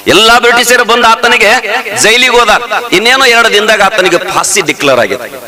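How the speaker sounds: high, ringing sermon voice; fervent village preacher; preaching at full force. Acted. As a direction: preach it out to the crowd. Preaching the story.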